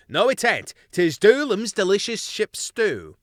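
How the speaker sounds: each cook claims the stew; rowdy crew squabbling over the name; arguing crew mice. Acted.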